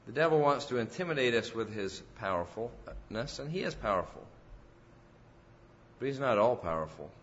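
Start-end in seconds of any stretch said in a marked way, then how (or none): none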